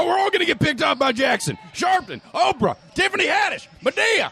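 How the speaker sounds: high shouted sing-song panic; panicked shouting. Acted.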